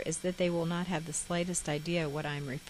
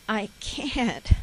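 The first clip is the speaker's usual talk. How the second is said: crying; 5 semitones higher, 5 dB louder than usual. Retold or imitated. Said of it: "I cried".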